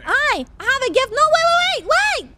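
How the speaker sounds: squeaky excited cheeky pleading; very high, loud, pushy and playful. Acted. Pushy gift offer to stranger.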